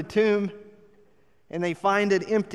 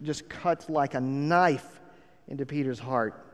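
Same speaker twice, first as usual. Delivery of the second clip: ordinary delivery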